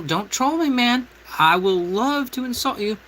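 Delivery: a hint of a taunting voice, tossed at trolls; quoting himself. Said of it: "This is why I tell people".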